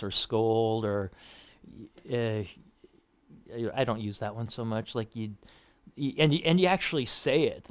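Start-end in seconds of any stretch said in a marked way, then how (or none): none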